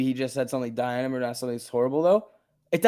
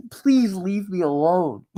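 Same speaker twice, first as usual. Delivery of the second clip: slow weary plea; 4 semitones higher, much slower than usual. Retold or imitated. Retold plea to paramedic.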